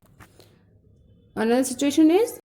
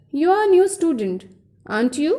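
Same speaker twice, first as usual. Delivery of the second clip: "student" falls, then "aren't you" rises; rising question tag; 4 semitones higher than usual. Shown as a demonstration. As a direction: lift the pitch at the end of "aren't you". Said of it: "Some more questions".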